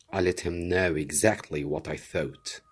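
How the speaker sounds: plain reading voice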